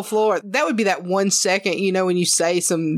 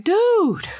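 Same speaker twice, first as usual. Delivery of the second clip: exasperated protest; 11 semitones higher than usual. Retold or imitated. Protesting to her boss in mock outrage. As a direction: push back in disbelief.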